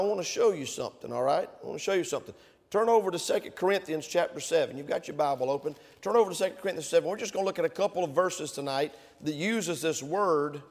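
ordinary talk, nothing marked

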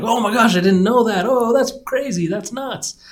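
high, breathless oh-my-gosh gushing; mock gushing reaction. Imitating an overwhelmed person, high mock gushing. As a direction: gush like someone dazzled by everything.

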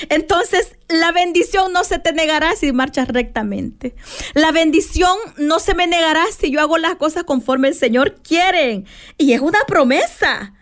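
usual speaking voice

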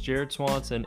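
ordinary delivery

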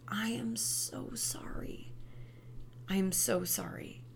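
comforting whisper. Shown as a demonstration. Comforting someone in pain.

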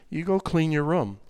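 low, soft and steady; calm firm instruction. Shown as a demonstration. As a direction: say it controlled, in the Spirit. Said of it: "while you're still in control".

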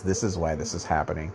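soft, assured explanation; calm authority. Pretend. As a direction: play the soothing man in charge. Authority figure explaining calmly.

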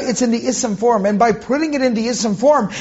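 ordinary talk, nothing marked

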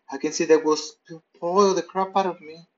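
usual speaking voice

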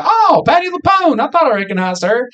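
imitating wife's high excited recognition. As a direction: mimic the wife's thrilled high squeal. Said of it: "My wife was like".

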